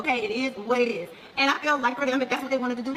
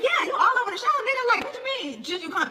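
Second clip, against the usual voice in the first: teasing mock exclamation; 10 semitones higher than usual. Her teasing phone reply retold.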